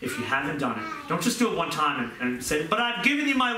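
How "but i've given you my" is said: mock believer. Mocking shallow believer.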